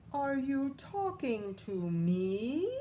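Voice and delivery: a lightly voiced affronted servant; indignant butler. A narrator's reading. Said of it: "the butler said".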